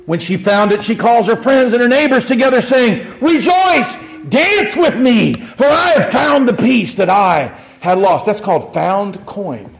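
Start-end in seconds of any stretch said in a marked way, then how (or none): 3.2-8.3 high shout, then slow exulting; jubilant woman. Acted. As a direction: call the neighbors to celebrate, stretching the words. Acting the woman who found coin.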